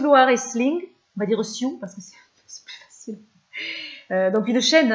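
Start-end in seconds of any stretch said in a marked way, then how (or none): 1.8-3.2 whispered aside. Real, not acted. Aside about shortening the name.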